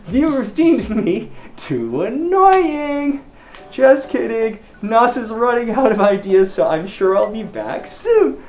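muffled inside mascot head; speaking behind full head mask; through a costume head. Real, not acted. Seen 3: Full deer costume head covers speaker's face during all labelled words.

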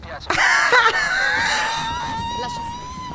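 a very high, crying shriek of laughter. Real, not acted. Streamer after clearing the mission, shrieking laugh. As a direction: let out a squealing laugh of triumph.

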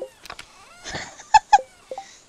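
loud, high squealing laugh. Real, not acted. Player laughing at the Junimos mocking her. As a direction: let a laugh burst up high.

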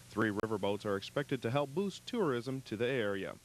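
normal talking voice

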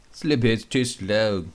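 lightly croaked frog line; croaky frog voice. Acted. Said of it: "croaks Beanbag Frog".

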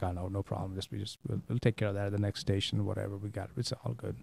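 very soft, low confidential undertone; hushed, low aside, as if for one listener; retold whisper. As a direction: play Dad quietly reassuring someone in his ear. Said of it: "my dad kinda whispers in his ears".

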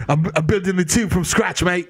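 mock British accent. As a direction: play a London bloke in a put-on British accent. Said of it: "He's like".

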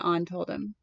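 ordinary delivery

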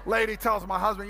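ordinary delivery

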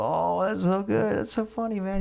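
high-pitched mock amusement; imitated laughing amusement. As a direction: play a tipsy pal who finds it hilarious. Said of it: "He starts laughing".

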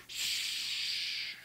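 long, voiceless shush; drawn-out disapproving hush. Acted. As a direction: silence the crowd with a sustained hiss. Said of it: "Pharisees quickly come along and say".

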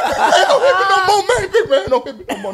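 high, laughing imitation of a beaten man pleading; mock begging. Teller mocking the man he beat, high mock pleading. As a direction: beg for mercy, hammed-up and high.

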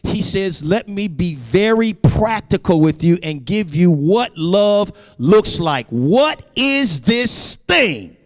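high, ringing sermon delivery; emphatic preaching. Real, not acted. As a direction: preach it with rising intensity, pitch held high.

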